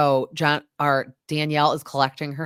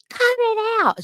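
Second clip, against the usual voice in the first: much higher, snapped mock yell; mock sharp yell; 4 dB louder than usual. Teresa yells at the women in the fight, mock shout. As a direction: imitate her shouting to stop.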